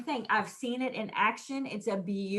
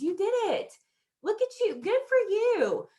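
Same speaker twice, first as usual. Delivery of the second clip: put-on high, bright-eyed praise to a child; beaming at a little one; enthusiastic praise; 10 semitones higher than usual. Shown as a demonstration. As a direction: cheer the kid on, pitch way up. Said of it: "to my kids versus".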